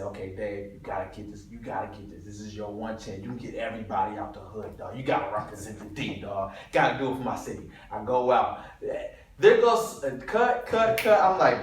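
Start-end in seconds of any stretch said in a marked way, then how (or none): 0.0-7.7 soft, low muttering to himself; self pep talk. Retold or imitated. Extra quietly hyping himself up before the take. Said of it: "I said". 9.4-11.2 loud call cut off by higher shouts of cut; yelled take. Retold or imitated. Extra yelling his line, director shouting cut.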